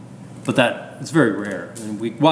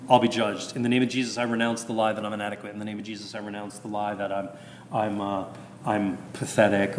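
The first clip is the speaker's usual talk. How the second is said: renunciation prayer; 3 semitones lower, 5 dB quieter than usual. Shown as a demonstration. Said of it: "you should say".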